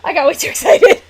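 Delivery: loud, high words squeezed through laughing; helpless laughter. Real, not acted. Streamer owning up to overexcitement, real helpless laughing. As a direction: crack up while confessing it. Cracking up after excited outburst.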